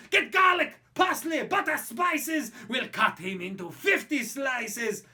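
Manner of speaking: high, loud greedy yelling; shouting French crowd. Acted. French mob shout over the giant snail, loud and high. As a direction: roar for a feast like a hungry mob. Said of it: "The French were shouting".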